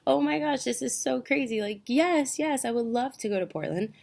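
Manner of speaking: high-pitched gushing acceptance; retold overjoyed reaction. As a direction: gush it, imitating your own thrilled yes. Retells her overjoyed reaction on call.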